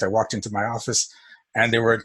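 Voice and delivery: usual speaking voice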